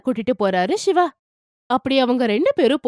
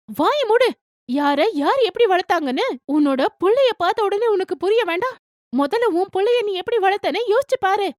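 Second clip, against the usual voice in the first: angry retort; 8 semitones higher than usual. A narrator's reading. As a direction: snap back at the mother-in-law hard. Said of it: "ரொம்ப கோவமா".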